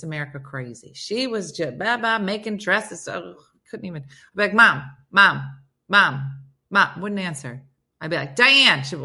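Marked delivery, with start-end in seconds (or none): 4.5-7.0 repeated louder calls, imitated; acted-out calling for attention; calling for Mom. Calling a mother who won't answer. 8.4-8.8 loud, higher attention-getting call; imitated summoning shout; calling out a name. Calls name to get attention.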